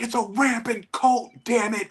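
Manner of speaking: mock outrage of a commenter correcting a name; mock angry commenter. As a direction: play the offended fanboy for laughs. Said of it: "Some guy got so mad".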